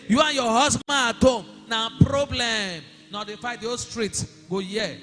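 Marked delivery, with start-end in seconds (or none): 0.1-2.8 loud, high preaching burst; raised-voice rebuke. Real, not acted. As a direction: raise your voice to scold the congregation. Preacher rebukes quarrelling churchgoers.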